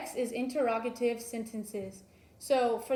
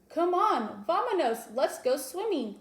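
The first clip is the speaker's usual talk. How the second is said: high, louder eager call to go; teacher reading an exciting command to students, eager; excited command; 6 semitones higher, 4 dB louder than usual. Shown as a demonstration. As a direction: call the kids to the water, thrilled. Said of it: "a command that's exciting".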